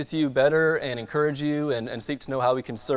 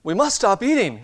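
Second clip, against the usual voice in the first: crying out; 9 semitones higher, 6 dB louder than usual. Acted. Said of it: "cried Toad as he ate another".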